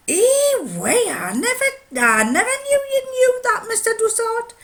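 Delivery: very high, loud, amazed Geordie; Geordie accent. Retold or imitated. Miner amazed by the watchmaker, Geordie accent. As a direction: marvel in squealed-up Geordie. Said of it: "he'd say it in Geordie".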